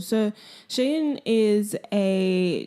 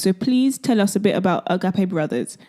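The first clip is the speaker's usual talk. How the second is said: ordinary talk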